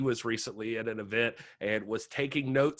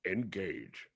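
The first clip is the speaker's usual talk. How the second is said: low, quiet starship captain order; captain's command; 4 semitones lower, 10 dB quieter than usual. Pretend. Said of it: "Sorry, I had to do that".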